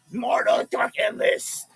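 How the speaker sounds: slow growled metal vocals; slow raspy growl, loud and drawn out. Acted. Metal-style growled shout.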